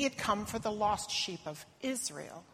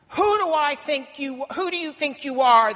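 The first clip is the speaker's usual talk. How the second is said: much louder, higher, hostile yell; shouted; 6 semitones higher, 10 dB louder than usual. Acted. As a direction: shout her down. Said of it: "they shouted at me".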